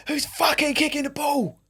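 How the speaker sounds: slow, high angry yell; angry shout. Retold or imitated. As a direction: bark it out like a furious caretaker. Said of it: "The guy's like".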